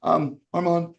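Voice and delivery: lightly voiced sick girl; weak girl's voice. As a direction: imitate her asking faintly. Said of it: "she goes".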